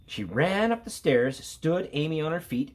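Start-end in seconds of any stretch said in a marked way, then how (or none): none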